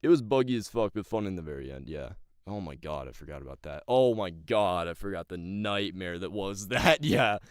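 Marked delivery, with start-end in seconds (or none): none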